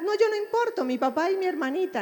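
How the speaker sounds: put-on high, lightly childish voice brushing himself aside; imitating the son. Therapist imitating the grieving son, high childish voice. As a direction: pitch it up like a young boy saying he doesn't matter. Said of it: "¿Y tú cómo estás?".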